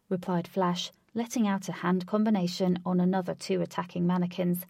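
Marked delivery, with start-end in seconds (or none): none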